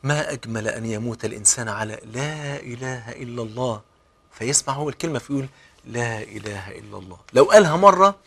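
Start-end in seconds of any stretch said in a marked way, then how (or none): 0.0-3.8 much softer, lower, tender prompt; a soft, loving nudge at a deathbed; gentle coaxing. Shown as a demonstration. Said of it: "بالأسلوب الجميل بقى كده". 5.8-7.2 much softer, lower murmur; a dying man's weak answer; barely-there whisper-soft words; faint reply. Retold or imitated. Dying man answers softly.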